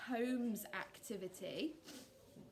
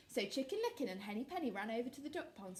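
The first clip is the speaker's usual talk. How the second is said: ordinary delivery, nothing marked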